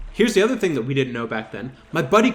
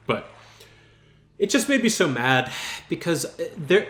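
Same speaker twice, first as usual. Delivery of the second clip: ordinary delivery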